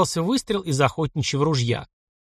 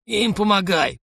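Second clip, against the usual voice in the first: put-on hoarse old man; 6 semitones higher, slower than usual. A narrator's reading. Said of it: "прохрипел дед".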